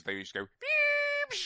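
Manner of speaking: very high electronic beep; modem beep. Retold or imitated. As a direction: squeal beep like a dial-up modem. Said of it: "modem-y noises".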